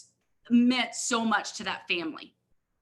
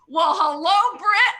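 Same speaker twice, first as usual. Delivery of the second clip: higher, louder, thrilled hello; delighted greeting; 7 semitones higher, 8 dB louder, slower than usual. Retold or imitated. Answering a call from the CEO, excited and louder. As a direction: greet the boss with bright delight. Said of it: "I'm like".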